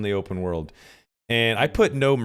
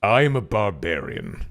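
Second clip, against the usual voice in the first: barbarian. Pretend. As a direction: play a big dumb brute. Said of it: "something like that".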